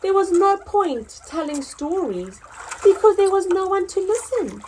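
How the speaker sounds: lively storybook narration, rising and falling; reading to children. Acted. Teacher reads Lost and Found to pupils, storytelling voice. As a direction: tell the story to a class of kids.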